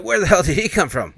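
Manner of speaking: loud, high-pitched mock alarm; startled outburst. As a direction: blurt it out as the startled driver. Driver startled by cop car.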